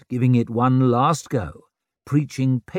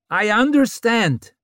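loud; 11 semitones higher, 4 dB louder than usual. A narrator's reading. Vic addresses crowd.

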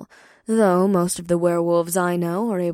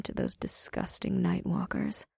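whispered disgust; 7 dB quieter than usual. Acted.

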